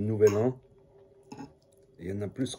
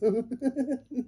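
high giggle; 14 semitones higher than usual. Real, not acted. Laughs at his own voice.

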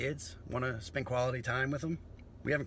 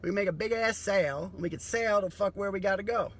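higher, louder hustler pitch; scheming salesman voice; 8 semitones higher, 4 dB louder than usual. Retold or imitated. Future scavenger pitching skinny jeans, higher hustler voice. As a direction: sell it like a street hustler with a plan. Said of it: "they'll be like".

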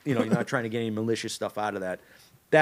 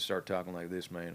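lightly put-on Southern accent, softer; 5 semitones lower, 10 dB quieter than usual. Shown as a demonstration. Voice actor shows a podcast host a Southern accent. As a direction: slip into a low-key Southern accent. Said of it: "you do a Southern accent".

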